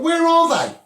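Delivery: sudden loud, high demand; shouting the question to the absent leaders; indignant shout. Real, not acted. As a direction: call them out, loud and high.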